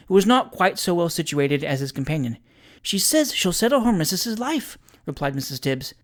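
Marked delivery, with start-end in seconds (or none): 2.8-4.8 high-pitched woman's character voice; scandalised eavesdropper, pitched high; alarmed landlady. A narrator's reading. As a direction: report it in a high, anxious voice. Alarmed landlady reporting what she overhears.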